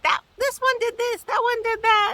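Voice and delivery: high squeaky old-lady mimic; high mock little old lady tattling; squabbling little old lady. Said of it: "They're just constantly like".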